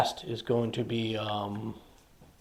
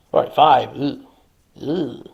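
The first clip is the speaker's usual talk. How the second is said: usual speaking voice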